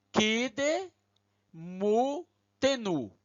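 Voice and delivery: "Kide, mu, tenu" with a short pause after each syllable. Shown as a demonstration. Teacher to class, reading the Hebrew word syllable by syllable. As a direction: read "Kide, mu, tenu" slowly, one syllable at a time. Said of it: "Lemos assim".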